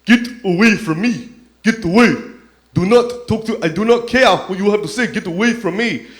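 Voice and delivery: put-on loud, high-pitched African-accented shout. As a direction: imitate an agitated man with an African accent. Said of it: "this African man saying".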